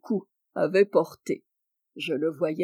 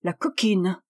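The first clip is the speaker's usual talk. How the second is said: indignant aside. Acted. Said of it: "À part".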